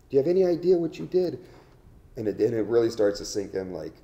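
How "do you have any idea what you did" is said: higher, louder, faster "Do you have any idea"; stern scolding. Retold or imitated. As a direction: drive it home like an angry lawyer. Lawyer scolding the prisoners.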